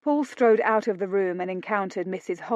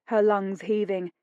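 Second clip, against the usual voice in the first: usual speaking voice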